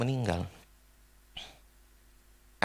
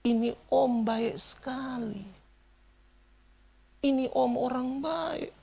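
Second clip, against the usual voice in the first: imitated tearful lament; 9 semitones higher, slower than usual. Stranger mourning at the coffin, imitated slow high weeping. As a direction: mimic someone grieving, drawing out each phrase. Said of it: "dalam tangisannya itu dia bilang begini".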